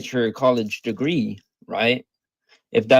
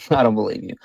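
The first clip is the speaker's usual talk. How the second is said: laughing; 3 semitones lower, much faster than usual. Real, not acted. Laughs at claim of never sinning.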